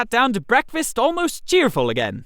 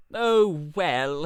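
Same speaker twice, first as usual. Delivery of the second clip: resigned; 4 semitones lower than usual. Acted. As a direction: give in with a tired shrug. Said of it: "he said resignedly".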